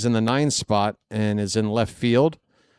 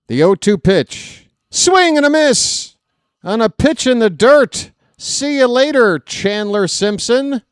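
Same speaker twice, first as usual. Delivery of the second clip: loud rising strikeout call; excited call; 9 semitones higher, 6 dB louder than usual. Real, not acted. Home run call, excited broadcaster.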